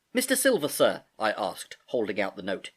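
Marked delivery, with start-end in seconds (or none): 0.1-1.0 high-pitched, tentative address; polite boyish query. Acted. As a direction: politely call to him in a higher voice. Boy approaches landlord with note.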